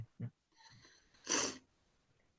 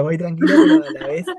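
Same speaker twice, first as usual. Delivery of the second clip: laughing; 13 semitones higher, 9 dB louder, faster than usual. Real, not acted. Laughs while defending himself.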